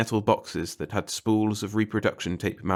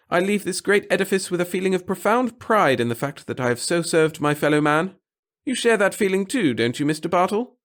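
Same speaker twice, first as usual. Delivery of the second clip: high, loud, self-important speech; pompous little clerk; 10 semitones higher, 4 dB louder than usual. A narrator's reading. The clerk grandly telling the reporter of his devotion to the center. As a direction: preach your humble service grandly.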